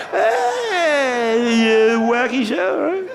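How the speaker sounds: high, slow mock-friendly falsetto; high sugary put-on voice; fake-nice jerk voice. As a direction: ham up a fake nice guy. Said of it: "The fucking jerk off I sound like".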